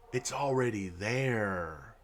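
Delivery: low and soft, slowed down, under the breath; quiet, slow aside. Real, not acted. To viewers about the goal, a soft slow mutter. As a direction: mutter it slowly to yourself first. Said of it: "keeps bringing up the transformative goal".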